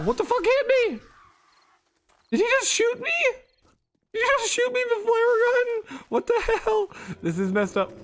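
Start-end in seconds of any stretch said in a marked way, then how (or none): 0.0-6.9 high shocked yelling; shocked disbelief. Real, not acted. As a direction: cry out in disbelief. Shot by a flare.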